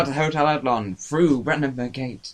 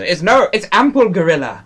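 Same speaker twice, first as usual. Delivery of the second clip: raised, loud comic cry of horror; mock alarm; 6 semitones higher, 7 dB louder than usual. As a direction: blurt out the dreadful discovery. Man spots gorilla, acted alarm.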